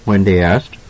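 usual speaking voice

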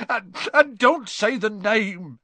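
high, stammering tearful plea; wailed, halting protest; sobbing. A narrator's reading. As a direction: plead slowly through acted sobs. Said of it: "sobbed Hagrid".

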